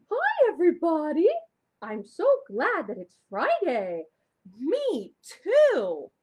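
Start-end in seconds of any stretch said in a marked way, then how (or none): 0.1-1.3 high, loud little puppet voice; puppet Shimmer. Acted. Puppet Shimmer greeting the children at home, high and loud. 4.6-6.1 high, loud little puppet voice; puppet Shimmer. Acted. Puppet Shimmer greeting the children at home, high and loud.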